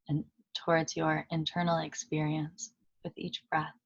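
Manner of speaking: calm meditation guide with long pauses between phrases; unhurried, even voice settling listeners into stillness; guided meditation. Acted. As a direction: lead them gently inward, leaving long silences.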